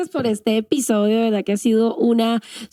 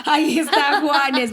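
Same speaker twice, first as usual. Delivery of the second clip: cheerful approval; 5 semitones higher than usual. Real, not acted. Host cheering the story's end.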